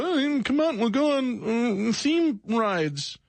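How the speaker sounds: high, slow dopey imitation; mocking listener voice. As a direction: imitate a dull suggestion, high and drawn out. Mocking listeners' dull suggestions.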